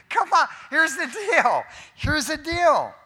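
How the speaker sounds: high, loud, amused regrouping; laughing it off. Real, not acted. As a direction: shake off the laughter and move on. Preacher laughing after the joke.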